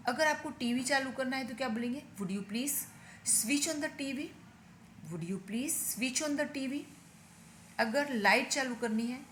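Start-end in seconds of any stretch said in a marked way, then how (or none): 2.2-6.8 said slowly word by word; slow drill. Shown as a demonstration. Teacher repeating the sentence slowly so students can practise. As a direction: spell it out slowly for listening practice. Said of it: "मैं दो तीन बार repeat करती हूँ".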